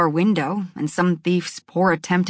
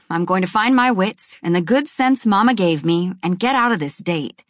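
plain reading voice

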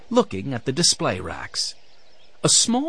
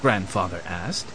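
usual speaking voice

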